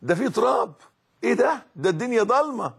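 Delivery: mocking a spoiled soul in the tomb; mock pampered whine. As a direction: mimic a pampered person disgusted by the grave. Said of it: "مش هتدخل تقول".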